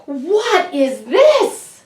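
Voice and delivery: high, slow, astonished ask; surprised question. A narrator's reading. Santa stunned by his new sleigh, asks in high slow surprise. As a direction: gasp it out in wonder. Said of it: "raised his eyebrows and asked".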